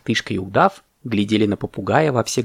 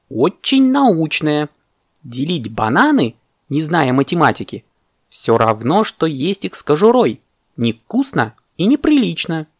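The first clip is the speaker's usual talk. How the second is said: higher, emphatic parrot retort; indignant parrot; 4 semitones higher than usual. Acted. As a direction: protest with swooping emphasis on key words.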